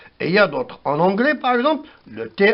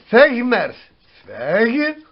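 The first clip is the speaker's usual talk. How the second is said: usual speaking voice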